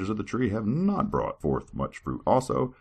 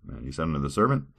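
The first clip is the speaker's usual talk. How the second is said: ordinary talk, nothing marked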